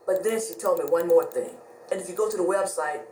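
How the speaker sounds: ordinary talk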